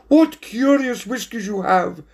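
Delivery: high-pitched grand lady cat; Lady Priscilla Perfect. A narrator's reading. Lady customer comments on the waiter's looks, high-voiced. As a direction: remark in a high, put-on lady's voice. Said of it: "remarked Lady Priscilla Perfect".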